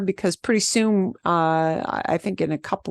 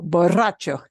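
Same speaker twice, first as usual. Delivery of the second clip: borracho at normal speed with a quick rolled rr; fast demo. Shown as a demonstration. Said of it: "Easier when I go fast".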